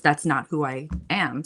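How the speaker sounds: usual speaking voice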